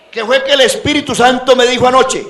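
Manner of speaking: high, loud mock prophet; mocking imitation. Preacher parodying claims of last night's revelation, mocking high voice. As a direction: parody someone boasting of a private revelation.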